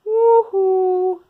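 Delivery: a loud, high whoop of joy; excited whoop. Real, not acted. Vlogger whoops at her fabric haul. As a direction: whoop it out over a fabric find. Thrilled over quilt book find.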